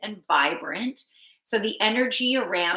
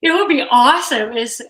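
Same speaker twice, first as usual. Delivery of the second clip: louder, fast words forced out through a big yawn; 5 semitones higher, 11 dB louder, faster than usual. Real, not acted. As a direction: talk right through a yawn. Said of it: "I'm sorry for yawning".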